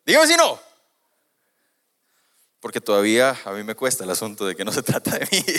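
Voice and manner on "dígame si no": far higher, louder playful dare; excited challenge. Real, not acted. Preacher daring the congregation, high and loud. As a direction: throw it out to the crowd laughing.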